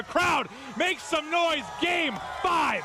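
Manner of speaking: crowd hype call. Real, not acted. Caster hypes the crowd.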